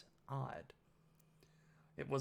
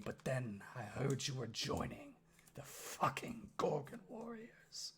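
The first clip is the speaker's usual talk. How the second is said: menacing orc father. Acted. Orc father grips Oski's arm, husky gruff menace.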